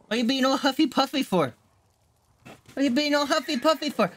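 high, sing-song pet voice. Real, not acted. Owner asking the huffing pet, high teasing baby talk.

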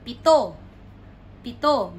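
"pito" as pi-TO; stress on last syllable. Shown as a demonstration. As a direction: punch TO in "pito". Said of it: "the fourth one".

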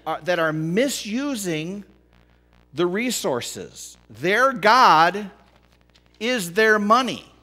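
high, loud, driving sermon; impassioned pulpit preaching; full-force preaching. Acted. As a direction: preach it hard to the congregation.

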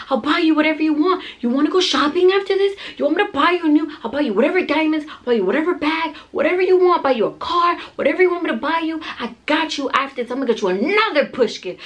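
high, insistent stream of promises; urgent pep talk. Retold or imitated. Partner bribes laboring mom to keep pushing, urgent pep talk. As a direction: imitate a frantic cheerleader, pushing hard. Partner bribing and urging her on.

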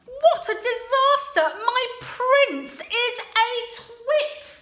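high, loud spitting rage; mock-furious princess fuming alone. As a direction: spit the words out. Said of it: "Sue started to spit".

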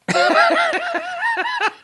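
high-pitched burst of laughter; real high laugh. Real, not acted. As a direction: crack up at the thought. Host laughs at the story.

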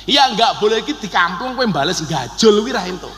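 ordinary talk

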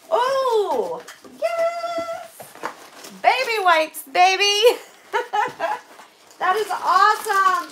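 high, loud delighted cheer; thrilled. Real, not acted. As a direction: whoop over a great gift. Delighted at donated baby wipes.

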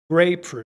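"Grapefruit" with GRAPE punched, "fruit" falling away; stress first part. Shown as a demonstration. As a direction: put real force on GRAPE in "Grapefruit". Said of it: "Mucha fuerza en la primera parte". ("Grapefruit" con mucha fuerza en la primera parte)

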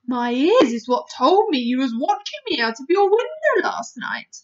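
loud, emphatic storybook giant; BFG. Acted. Giant tells Sophie his ears found her out, loud and animated. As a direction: reveal the secret with a giant's pride.

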